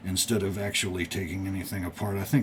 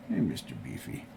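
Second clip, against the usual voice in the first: cooing to the cat; 9 semitones lower, 8 dB quieter than usual. Real, not acted. Greeting the cat.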